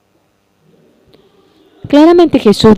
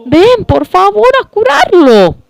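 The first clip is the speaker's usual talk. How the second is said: urgent plea; 14 semitones higher than usual. Acted. Sisters begging Jesus to come.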